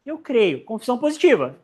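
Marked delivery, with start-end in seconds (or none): none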